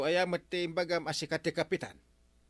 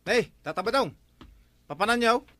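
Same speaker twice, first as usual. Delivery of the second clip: calling out; 6 semitones higher, 8 dB louder than usual. Acted. Calling out to the councillor.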